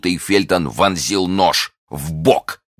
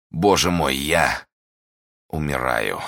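dying; 2 semitones lower, 4 dB quieter, slower than usual. A narrator's reading. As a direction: voice sinking lower and softer. Duke is dying.

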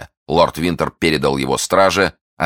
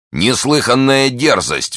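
high, drawn-out indignant exclamation; outraged cry; 7 semitones higher, 3 dB louder, slower than usual. A narrator's reading. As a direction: cry out slowly, stressing the outrage. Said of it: "вскричал Бекингем".

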